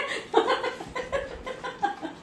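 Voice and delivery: soft unvoiced laugh; breathy laugh. Real, not acted. Mother laughing softly at the kids' squabble. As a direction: let a quiet airy laugh out.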